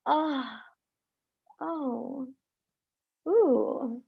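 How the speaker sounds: gasp, then let the feeling break through softly; gasping release. Shown as a demonstration. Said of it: "demonstrating emotional release".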